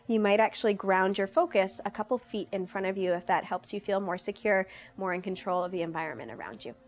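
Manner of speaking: usual speaking voice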